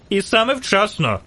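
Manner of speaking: mild, high exclamation; called out. A narrator's reading. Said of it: "крикнув жовтець".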